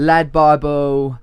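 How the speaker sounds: put-on loud, drawn-out London accent; London accent impression. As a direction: stretch it slowly and loudly in mock London. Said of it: "Like a London accent".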